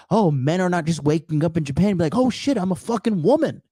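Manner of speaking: mock clueless man. Mocking imagined Japanese men.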